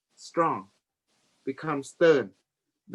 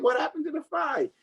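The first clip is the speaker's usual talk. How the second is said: laughing protest; 12 semitones higher than usual. Retold or imitated.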